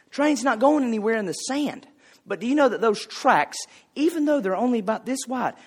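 ordinary talk

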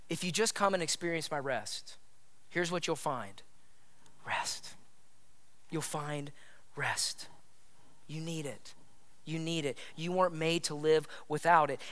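very soft, low, near-whisper with long pauses; hushed divine invitation. Acted. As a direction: let each phrase sink in, barely voiced. Voicing God's invitation to rest.

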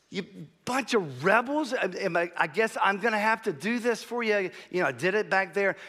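high, loud mock-arrogant griping; put-upon boasting, acted out with heat; arrogant anger. Preacher acting Moses lording it over the rebels, arrogant anger. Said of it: "arrogance seems to have settled on him in his anger".

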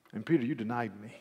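ordinary talk, nothing marked

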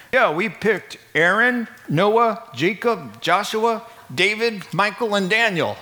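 a lightly voiced boastful committee; mock apostles. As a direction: play the smug committee listing their picks. Mock voice of the apostles picking Hebrews.

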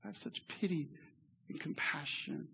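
a soft, near-silent sigh of pity; hushed whisper of compassion; whispered parent's pity. Retold or imitated. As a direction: whisper it like a parent shaking their head. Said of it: "like a parent ... he's like".

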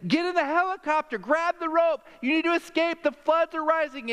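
high, insistent rescuer's call; urgent calling out. Retold or imitated. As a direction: call down from a hovering helicopter. Helicopter rescuer calling to man.

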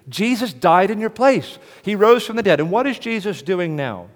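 loud, high, ringing preacher's cry; full-force preaching. Acted. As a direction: thunder it from the pulpit, slowing on key lines.

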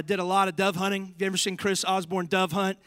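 ordinary talk, nothing marked

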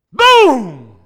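explosive shout of anger; sudden boom. Pretend. Preacher erupting like a match into a shout. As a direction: blow up all at once on the word.

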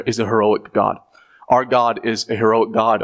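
ordinary delivery

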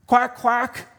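high, loud quacking mother duck. Acted. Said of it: "mother duck said".

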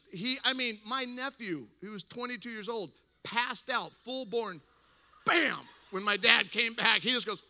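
normal talking voice

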